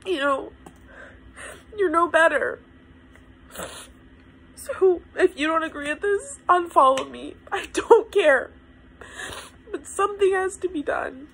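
high, emotional insistence after crying; worked up and tearful, pitched high; real tearful upset. Real, not acted. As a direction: push on firmly through the tears. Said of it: "you've experienced my breakdown".

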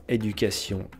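usual speaking voice

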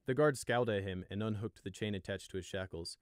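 ordinary delivery

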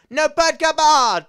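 long, high, loud call after the bird; drawn-out shout after a runaway bird. Real, not acted. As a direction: yell at it to come back, stretching it slowly. Yelling at an animal in-game.

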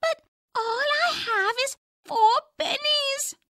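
lightly voiced high little skunk; a small pleading creature. A narrator's reading. Father voicing Roger Skunk for daughter, high small voice.

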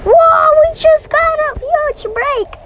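very high shocked whoa; surprised squeal. Real, not acted. Surprised by game break.

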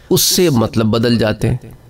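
ordinary delivery

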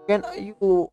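usual speaking voice

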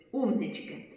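ordinary talk, nothing marked

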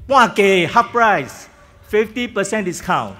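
high, loud excited announcement. Real, not acted. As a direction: announce it with delight.

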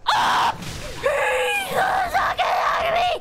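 high startled cry; startled yelp. Real, not acted. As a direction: jump out of your skin. Sudden scare.

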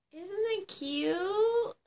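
high, slow cutesy squeal; cutesy gush. Pretend. Vlogger showing phone case to viewers, high cutesy voice. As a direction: coo over the case adoringly.

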